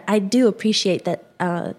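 ordinary talk, nothing marked

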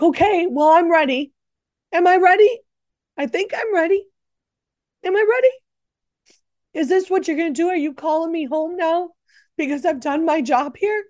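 high, wavering uncertainty that climbs with each question; nervous asking. Retold or imitated. Retelling her talk with God in bed, high nervous doubt. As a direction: ask yourself nervously whether you're ready.

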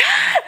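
high startled scream; sudden panicked yelp; panicked shriek. Retold or imitated. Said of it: "I'm like".

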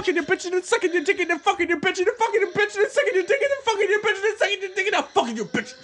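fast high screamed rap; incoherent screaming. Pretend. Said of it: "it sounds like I'm screaming something".

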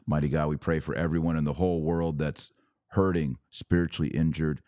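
ordinary talk

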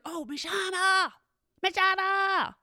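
put-on high, pleading little-kid call, slowly drawn out; eager kid calling. Eager kid calling the teacher, high and drawn out. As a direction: beg for her attention like a child.

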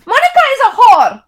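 put-on very high, loud accented scream; Indian mother screaming. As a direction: scream the insult at your husband. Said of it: "I read in my characters' voices".

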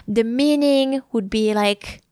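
ordinary talk

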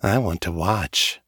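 low, sultry invitation; seductive. A narrator's reading. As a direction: purr it out seductively. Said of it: "I say in my most seductive voice".

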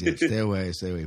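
normal talking voice

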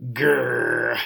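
drawn-out growl of loathing; grudging, hateful "grrr"; angry growl. Acted. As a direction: growl with disgust at a hated rival. Opening growl of jealous monk's monologue.

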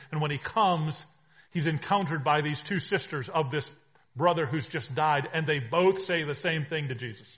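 loud, urgent sermon delivery; preaching at full force. Acted. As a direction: drive the story home to the congregation. Preacher building the story.

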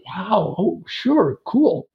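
light up at the news; delighted surprise. Retold or imitated.

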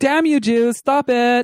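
high-pitched, unintimidating protest; hammed-up, toothless telling-off; harmless mock scolding. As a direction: scold feebly in a silly high voice. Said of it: "terrifying a message when you say it that way".

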